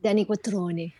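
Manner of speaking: name said the Italian way, kwatroni; a light Italian accent on Quattrone. Shown as a demonstration. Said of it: "say it with Italian accent".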